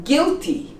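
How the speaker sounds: guilty slowly, as "gilty"; silent U. Shown as a demonstration. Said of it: "we don't pronounce".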